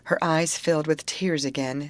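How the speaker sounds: usual speaking voice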